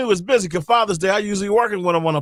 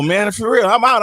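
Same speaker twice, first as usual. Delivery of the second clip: ordinary talk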